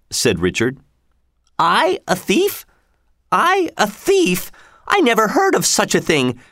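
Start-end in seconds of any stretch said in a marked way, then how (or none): 1.6-6.4 high-pitched outraged protest; indignant cry. A narrator's reading. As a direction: protest your innocence, voice jumping right up. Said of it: "she cried".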